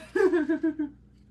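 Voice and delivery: high, loud cackling laugh; loud burst of laughter. Real, not acted. Reading a viewer's speeding story, bursting into loud high laughter. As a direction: crack up at the story.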